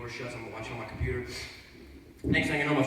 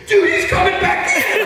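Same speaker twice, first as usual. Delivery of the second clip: high, loud, slow alarmed shout; shouted warning; 14 dB louder than usual. Retold or imitated. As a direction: yell the warning down the hall. Friend warns, shouted.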